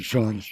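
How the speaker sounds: Jorge said as one syllable, ZHORZH; Portuguese Jorge, with no Spanish h; Portuguese pronunciation. Shown as a demonstration. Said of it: "The Portuguese pronunciation is".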